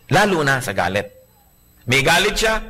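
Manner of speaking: ordinary talk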